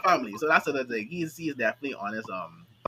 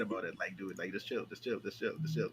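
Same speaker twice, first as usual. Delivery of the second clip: quiet; 8 semitones lower, 12 dB quieter than usual. Retold or imitated. Telling to calm down.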